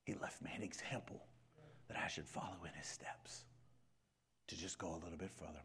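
soft confiding whisper; hushed personal whisper. Acted. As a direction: whisper it like a private vow.

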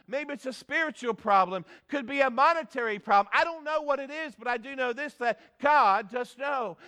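normal talking voice

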